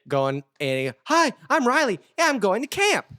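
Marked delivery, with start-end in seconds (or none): 1.1-3.0 put-on very high, loud excited kid voice; Riley. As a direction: imitate a ramped-up little boy introducing himself. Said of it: "he is ramped up".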